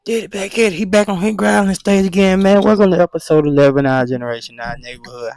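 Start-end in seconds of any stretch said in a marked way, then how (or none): 2.6-5.3 quick, punchy show intro; fast hype intro. Shown as a demonstration. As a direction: rattle off the intro with energy.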